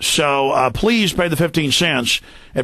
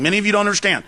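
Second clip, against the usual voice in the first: ordinary delivery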